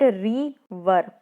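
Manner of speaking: River slowly broken into री and वर; split into syllables. Shown as a demonstration. Teacher showing children two syllables, river said in separate parts. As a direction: say री, pause, then वर. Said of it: "इसमें हमारी अलग आवाज़ निकली".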